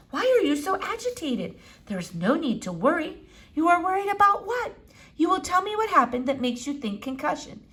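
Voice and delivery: a hint of an old eccentric doctor; Doctor Measham. A narrator's reading. Old doctor peering at the squirrel, lightly foreign.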